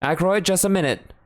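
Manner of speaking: doctor calling through the locked study door. A narrator's reading. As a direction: call to the man inside. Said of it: "called out".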